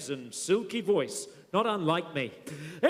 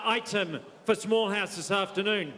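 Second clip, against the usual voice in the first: usual speaking voice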